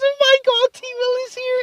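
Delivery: very high, loud mock squeal; excited drunk announcement. Forgetful drunk friend announcing T Will to everyone, mock excited squeal. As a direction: imitate a drunk friend shrieking the news. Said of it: "And he completely forgot".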